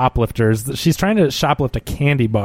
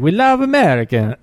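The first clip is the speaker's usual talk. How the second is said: put-on Swedish accent; 4 semitones higher than usual. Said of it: "they're like Swedish or from Norway".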